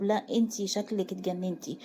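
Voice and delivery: plain reading voice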